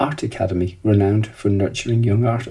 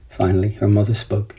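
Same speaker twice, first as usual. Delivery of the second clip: ordinary delivery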